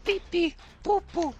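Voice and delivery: silly sing-song. Real, not acted.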